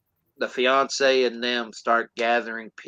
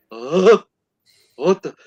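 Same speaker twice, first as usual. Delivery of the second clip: startled; 6 semitones higher, 5 dB louder than usual. Retold or imitated. Acts out executioner seeing monster.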